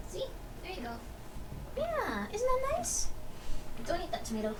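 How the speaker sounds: soft coaxing to the cats; hushed pet talk. Real, not acted. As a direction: coax the cat quietly.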